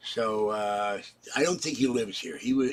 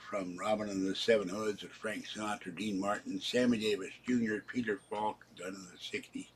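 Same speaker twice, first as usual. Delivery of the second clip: ordinary delivery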